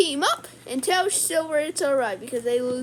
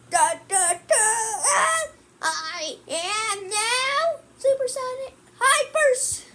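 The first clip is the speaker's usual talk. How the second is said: slow high heroic announcing; transformation voice; 6 semitones higher, slower than usual. Pretend.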